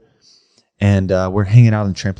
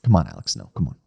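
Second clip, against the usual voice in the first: retold; 8 semitones lower, 6 dB quieter, faster than usual. Retold mother dismissing prankster child.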